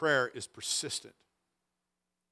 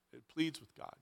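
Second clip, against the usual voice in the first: quiet repeat; 4 semitones lower, 14 dB quieter than usual. Shown as a demonstration.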